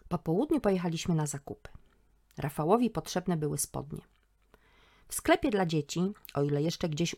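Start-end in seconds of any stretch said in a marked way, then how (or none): none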